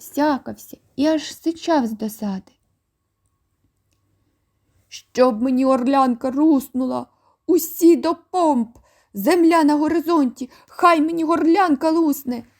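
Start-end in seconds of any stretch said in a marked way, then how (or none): 4.9-12.4 loud, high blustering sea dog; shouting admiral. A narrator's reading. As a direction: bellow it like an angry old sailor. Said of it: "кричав адмірал". (адмірал сердито кричить у клітці)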